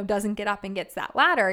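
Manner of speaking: usual speaking voice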